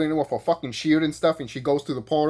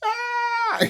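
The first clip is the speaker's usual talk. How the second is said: emotional aww. Retold or imitated. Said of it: "And you just be like".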